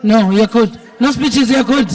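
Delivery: usual speaking voice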